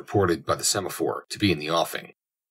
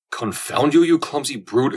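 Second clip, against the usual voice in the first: high, loud burst of indignation; angry exclamation; 8 semitones higher, 5 dB louder, slower than usual. A narrator's reading. Captain shaken by a collision with a stranger, angry exclamation. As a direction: curse out whoever just bumped into you. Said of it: "exclaimed the captain, shaken by the collision".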